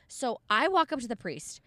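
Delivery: usual speaking voice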